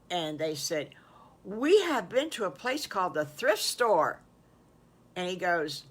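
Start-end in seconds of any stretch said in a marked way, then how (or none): 1.4-4.2 tag pending. Acted. Friends proudly answer the wolf.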